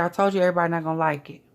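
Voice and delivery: normal talking voice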